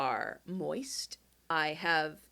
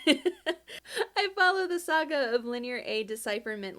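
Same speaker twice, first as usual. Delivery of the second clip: reading through laughter, higher and louder; laughing through the words of a fan letter; laughing while reading; 7 semitones higher, 6 dB louder than usual. Real, not acted. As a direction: read it while cracking up.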